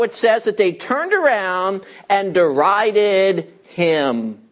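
usual speaking voice

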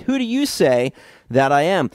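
"you" pushed out clearly, the rest lighter; quizzing the disciples, "you" set apart from the crowd; stress on you. Shown as a demonstration. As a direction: lean on "you" as the pointed question. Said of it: "And it's emphatic here".

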